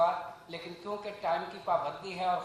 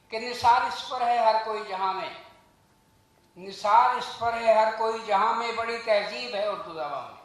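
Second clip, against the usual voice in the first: measured, slightly raised recital of a couplet; poem recital. Acted. Speaker recites Urdu couplets to the audience, poetic recital. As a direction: recite it like verse at a gathering.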